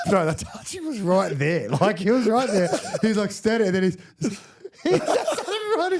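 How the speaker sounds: high, laughing through the words. Real, not acted. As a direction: laugh while telling it. Laughing telling ref story.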